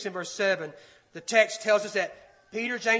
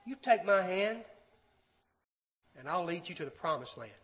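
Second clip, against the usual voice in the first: gentle invitation, growing softer; voice of truth; 3 semitones lower, 6 dB quieter than usual. Acted. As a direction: offer guidance tenderly, sinking to a hush. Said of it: "The voice of truth is saying".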